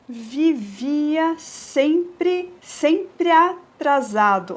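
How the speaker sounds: "sempre atrasado" very slow, pre and a joined; slow scansion. Shown as a demonstration. Teacher scans the verse slowly for students. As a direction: drag out "Vivia sempre", join "sempre atrasado". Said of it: "eu juntei de novo".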